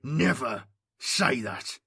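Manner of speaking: slow, intense hiss; slow menacing hiss. A narrator's reading. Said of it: "he hissed".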